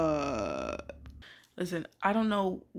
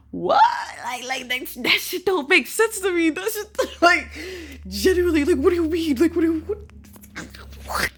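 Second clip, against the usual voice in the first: high, loud disbelieving shout; incredulous outburst; 11 semitones higher, 7 dB louder than usual. Real, not acted. Ranting at people who feel undeserving, high incredulous shout. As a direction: yell it in laughing disbelief.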